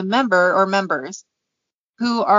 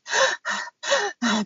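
gasping breath; 10 semitones higher, 5 dB quieter than usual. Retold or imitated. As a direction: gasp for air after a dance. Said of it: "breathing heavily".

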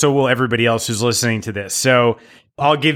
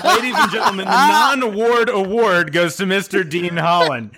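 mock award presenter; 5 semitones higher than usual. Mock award ceremony announcement.

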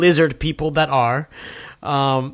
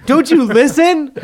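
playful scold, higher and louder; mock scolding; 10 semitones higher, 5 dB louder than usual. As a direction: scold the listeners in jest.

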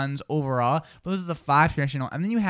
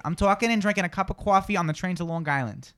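New York accent with rounded coffee vowels; New York City accent. Shown as a demonstration. Host models New York accent for Natalie to copy. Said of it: "New York City".

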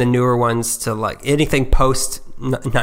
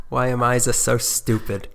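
usual speaking voice

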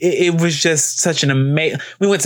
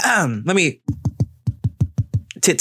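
normal talking voice

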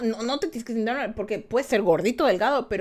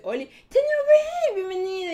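squeaky greeting; 10 semitones higher, 3 dB louder than usual. Pretend. Reading a chat greeting aloud.

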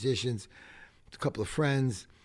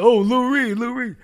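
high, loud fan call, re-enacted; excited calling out; 11 semitones higher, 12 dB louder than usual. Fans spotting their idol on the street, calling out excitedly. Retold calling out to [name].

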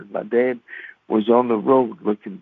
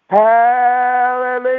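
high, slow, loud joyful holler; hollered hallelujah; 11 semitones higher, 5 dB louder, slower than usual. Retold or imitated. Mama hollers hallelujah at the door, high and loud. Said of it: "I heard her holler".

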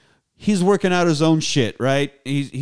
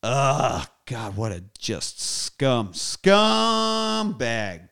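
drawn-out, rising scumbag; real disgust; much slower than usual. Real, not acted. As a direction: stretch the insult out with disgust. Disgusted insult at film villain.

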